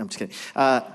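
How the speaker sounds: ordinary talk, nothing marked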